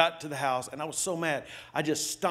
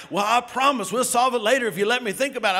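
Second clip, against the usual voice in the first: imitating wife, fast plea; 8 semitones higher, 6 dB louder, faster than usual. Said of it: "and she'd say".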